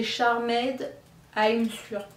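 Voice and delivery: English name in a French accent. Real, not acted. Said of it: "Excusez-moi mon accent".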